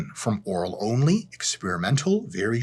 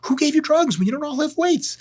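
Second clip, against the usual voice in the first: climbing much higher in disbelief; incredulous scolding; 12 semitones higher than usual. Retold or imitated. Lifter mocks a clueless gym guy, incredulous scolding. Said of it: "and you're like".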